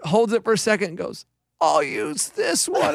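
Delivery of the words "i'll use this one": high, slow, solemn little pronouncement; dramatic kid. Retold or imitated. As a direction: deliver it like a tiny actor. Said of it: "exact dramatic pause".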